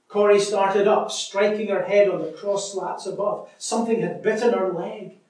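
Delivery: lively read-aloud with voiced sisters; story reading. Preacher reading a story aloud to church, lively.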